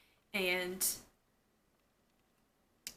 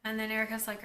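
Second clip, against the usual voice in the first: usual speaking voice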